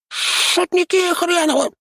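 very high, slow, grumbling creature voice. A narrator's reading. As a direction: grumble it out like a sulky imp. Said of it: "буркнул Шнырька".

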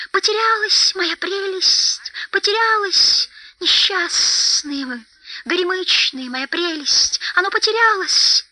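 high creature lament; desperate, drawn-out high keening; wailing Gollum. A narrator's reading. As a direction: wail it high with hissed endings. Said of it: "бранился и причитал Голлум".

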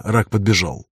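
plain reading voice

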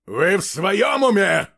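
loud, high, drawn-out outburst; angry shout. A narrator's reading. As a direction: explode in disbelief. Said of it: "Он сорвался на крик". (гневный крик)

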